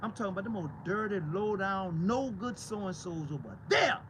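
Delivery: ordinary talk, nothing marked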